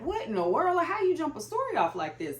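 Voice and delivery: wide-eyed at how fast the story starts; amazed disbelief. Retold or imitated. Telling viewers how the opening hit her, amazed and high. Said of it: "And I'm like".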